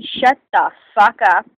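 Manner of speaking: deliberate, forceful telling-off; retold angry demand. Student in the bully's face, slow angry demand.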